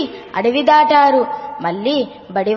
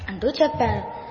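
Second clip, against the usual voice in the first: normal talking voice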